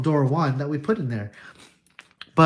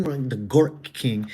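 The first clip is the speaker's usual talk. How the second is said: ordinary talk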